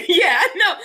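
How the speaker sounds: laughing. Real, not acted. Laughing agreement.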